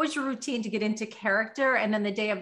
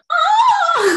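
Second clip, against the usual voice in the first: long high-pitched whoop; loud high cry; 10 dB louder than usual. Retold or imitated. Actress in neighboring dressing room, imitated loud high cry. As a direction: belt out a drawn-out high "oh".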